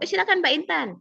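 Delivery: high-pitched, inviting examiner; lightly voiced examiner, pitched well up; examiner giving go-ahead. Examiner letting the candidate answer, giving the go-ahead. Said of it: "kalau penguji, lihat intonasi penguji".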